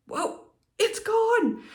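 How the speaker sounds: high, slow astonished exclamation; amazed whoa. Retold or imitated. Soap scum wiped clean away, high slow amazed whoa. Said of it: "this was just like".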